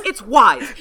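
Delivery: "Ys" said as "wise", rhyming with "eyes"; wise pronunciation. Shown as a demonstration. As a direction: say it "wise", not "ease". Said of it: "stop it with the E's thing".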